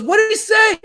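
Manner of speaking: very high, frantic mock demand; squeaky urgent question; retold urgent question. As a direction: imitate a desperate host, voice shooting up. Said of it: "He goes".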